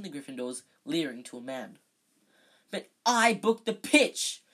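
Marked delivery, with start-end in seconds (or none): 2.7-4.4 loud, high, slow furious protest; captain spitting with rage at his rival. Acted. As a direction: spit each word out in rage. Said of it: "positively spitting with rage".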